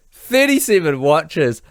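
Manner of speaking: high, loud and slowed in disbelief; incredulous. Real, not acted. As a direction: stretch it out, stunned. Disbelief at watching the film repeatedly.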